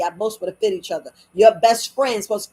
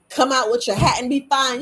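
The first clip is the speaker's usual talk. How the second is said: usual speaking voice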